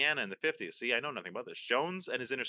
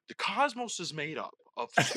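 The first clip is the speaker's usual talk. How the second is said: lightly imitated science presenter; a hint of grand cosmic narration; Neil deGrasse Tyson. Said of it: "who's always like".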